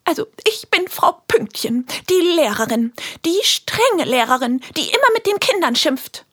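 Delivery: high, bossy schoolteacher voice; strict teacher. Acted. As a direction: play a strict scolding teacher. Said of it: "spielt ihre Rolle auch gleich schon mal vor".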